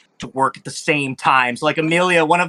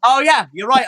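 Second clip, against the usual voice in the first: ordinary talk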